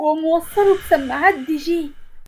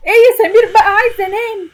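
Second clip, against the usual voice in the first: high, loud burst of exasperation; exasperated; 6 semitones higher, 6 dB louder, faster than usual. Acted. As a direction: plead crossly with a husband keeping you up. Wife kept awake by snoring husband.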